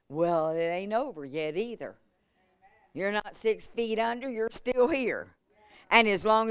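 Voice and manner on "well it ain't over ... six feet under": ordinary talk, nothing marked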